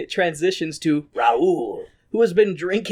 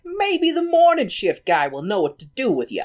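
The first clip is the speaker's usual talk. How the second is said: put-on higher American wise-guy accent; put-on barkeep twang, pitched up; American bartender; 8 semitones higher than usual. Bartender at shift change, higher mock American accent. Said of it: "the bartender is like".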